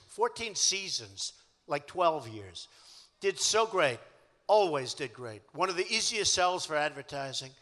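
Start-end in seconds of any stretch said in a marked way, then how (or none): none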